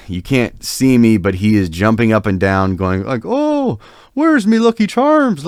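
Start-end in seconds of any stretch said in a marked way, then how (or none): 3.2-5.5 high, squeaky mock Irish brogue; mock Irish accent. Cousin mocking Irish guys, hammed-up Irish accent. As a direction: drunk cousin hamming up a fake Irish accent. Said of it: "starts mimicking their accent".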